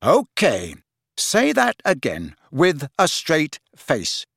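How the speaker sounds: slow, deliberate challenge; disbelieving. Acted. Friend daring him to repeat it, disbelieving. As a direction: spell it out slowly, disbelieving. Harry challenges absurd confession.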